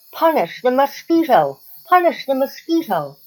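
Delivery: high-pitched, chanting outcry; crying out. A narrator's reading. All the animals demanding punishment at the council, crying out. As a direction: cry out like a whole crowd of animals. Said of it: "cried all the animals".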